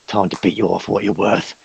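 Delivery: lightly voiced orc ringleader egging on the fight; hint of a rowdy fight host. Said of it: "It's like".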